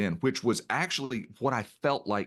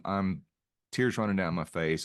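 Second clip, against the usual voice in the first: normal talking voice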